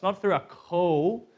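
usual speaking voice

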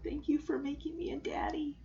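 soft whisper of a grateful new dad; whispered thanks. Retold or imitated. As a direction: breathe it tenderly as a whisper. Tender Jesse line whispered.

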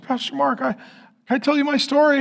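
a hint of a woman near tears asking timidly; imitating an emotional woman. Emotional woman approaching the pastor.

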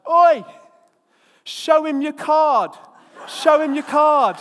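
high, loud, slow yell across the field; frantic shout. Retold or imitated. Farmer yelling to the fleeing inspector at full shout. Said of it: "he shouts".

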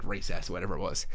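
ordinary talk

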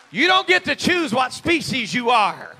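loud and slow, every word hammered; loud slow declaration. Real, not acted. Preacher declaring to the congregation, loud and slow. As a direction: lay it down like law.

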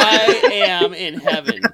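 laughing through the words; delighted, cracking up. Real, not acted.

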